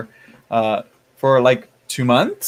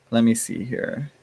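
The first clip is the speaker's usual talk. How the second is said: ordinary talk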